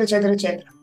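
ordinary talk

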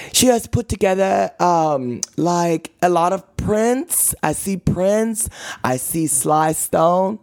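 slow, high mock English accent; deliberate, badly faked English accent; attempted English accent. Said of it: "my English accent again".

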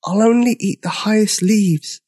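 high-pitched, voiced stage whisper; small high dinosaur voice; tiny timid voice. A narrator's reading. Big dinosaur promising George, tiny timid voice. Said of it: "he whispered".

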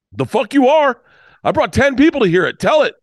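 insistent high-pitched demand; indignant fan. Retold or imitated. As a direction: imitate an outraged fan, pitch jumping up. Heckling fan refuses the retirement.

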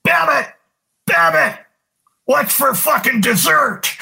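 high mock yell from the dugout steps. Manager yelling from the top steps at the player. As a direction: shout it across the field, teasing. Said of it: "is yelling".

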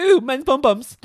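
loud, high disgusted squeal; coworker's squeal. Retold or imitated. Office coworker reacting to talk of bums, loud high squeal. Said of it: "someone in the back of the office went".

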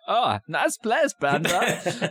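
high, slow, badly faked New York accent; quasi New York accent. Host doing his best as Brenda's visitor, quasi New York accent.

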